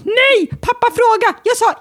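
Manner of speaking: put-on high whiny kid voice; high child begging to see Maja; imitating child whining. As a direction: whine it like a four-year-old.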